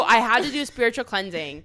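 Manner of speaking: normal talking voice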